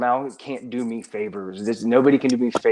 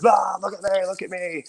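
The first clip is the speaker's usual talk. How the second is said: lightly hammed-up attention-seeker, higher; showing off for the camera, pitched up; mock show-off; 7 semitones higher than usual. Mocking himself as a camera show-off, higher voice. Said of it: "being like".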